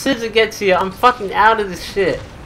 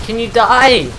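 louder, irritated demand; exasperated shout; 3 semitones higher, 4 dB louder than usual. Real, not acted. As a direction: bark it at an enemy that won't fall. Exasperated repeats at enemy.

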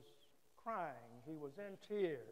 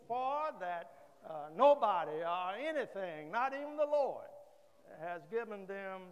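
full-force preaching; 2 semitones higher, 5 dB louder than usual. Real, not acted. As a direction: press the congregation with fervor. Pastor preaches with rising force.

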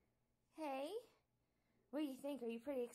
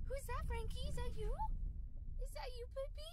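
much higher cooing questions; 11 semitones higher than usual. Real, not acted. Owner cooing at puppy Frankie, high baby talk.

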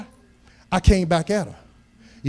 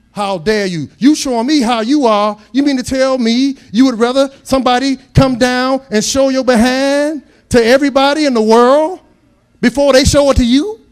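higher, louder rising rebuke; indignant preaching; 7 semitones higher, 7 dB louder than usual. Acted. Preacher scolding fathers who allow it, indignant and loud. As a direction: scold them from the pulpit.

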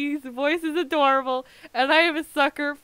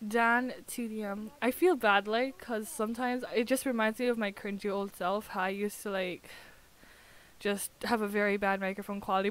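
ordinary talk, nothing marked

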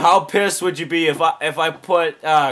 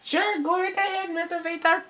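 high, stiff alien, softer; Conehead; 13 semitones higher, 6 dB quieter than usual. Acted. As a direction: grant permission in a soft, pitched-up alien voice. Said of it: "I'm gonna do my Conehead impression".

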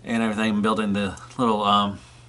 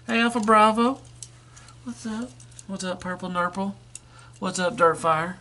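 much higher cheery hellos; high toy greeting voice; 8 semitones higher than usual. Pretend. The toy greets the other toys one by one, high cheery hellos. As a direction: call out to buddies like a cartoon robot.

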